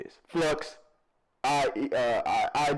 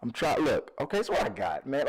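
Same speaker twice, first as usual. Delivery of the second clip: ordinary talk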